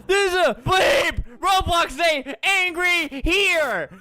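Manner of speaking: high, slowed hammed-up outrage; mock angry shout. Acts out an angry player.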